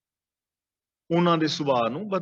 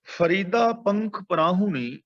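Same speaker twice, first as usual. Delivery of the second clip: slow scripture recitation; slower than usual. Acted. Reciting Farid's verse in sermon.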